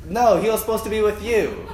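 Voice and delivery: hammed-up indignant comeback; mock bickering parent. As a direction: pin the blame on the other parent. Parents blame each other over child.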